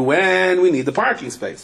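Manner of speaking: normal talking voice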